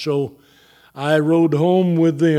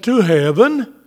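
higher, with a lifted, of-course ending; as if obvious; 5 semitones higher, 3 dB louder than usual. Retold or imitated. Husband repeating wife's answer, as if it were obvious. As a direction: imitate her "surely you knew that" tone. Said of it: "in that tone of voice".

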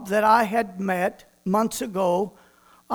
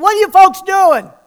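much louder and higher call; startled stranger calling out; 7 semitones higher, 10 dB louder than usual. Retold or imitated. Stranger interrupting two men praying, loud call. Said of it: "somebody came up behind him and said".

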